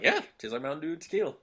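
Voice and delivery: happily embrace the very same words; quick bright approving reply; cheerful agreement. Retold or imitated. Own answer set against sister's quote.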